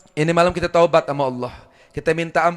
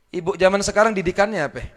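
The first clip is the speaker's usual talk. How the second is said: normal talking voice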